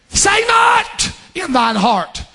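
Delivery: high, stretched-out scripture cry; preaching cry. Real, not acted. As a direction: cry the verse out, drawn long.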